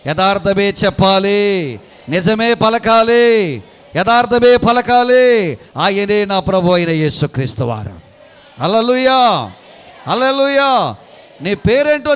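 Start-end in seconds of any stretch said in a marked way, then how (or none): 0.1-10.9 fervent preaching. Acted. Preaching climax, loud and slow.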